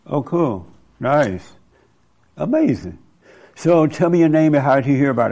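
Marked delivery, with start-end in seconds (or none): none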